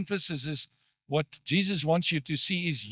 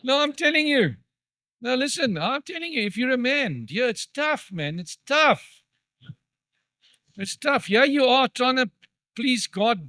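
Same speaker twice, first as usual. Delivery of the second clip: much higher and louder, pleading with the men; fiery preaching; 6 semitones higher, 5 dB louder than usual. Acted. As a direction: preach it at full force to the husbands. Preaching at full force.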